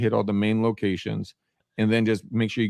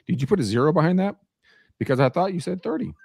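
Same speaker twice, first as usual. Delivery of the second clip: retold incredulous question, pitched up; politely stunned, higher voice; mock disbelief; 7 semitones higher, faster than usual. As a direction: replay your baffled double-check. Retold disbelief at open house count.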